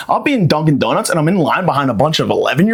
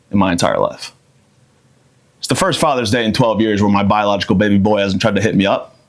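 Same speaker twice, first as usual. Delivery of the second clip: ordinary delivery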